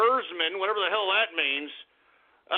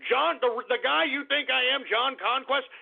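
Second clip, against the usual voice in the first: normal talking voice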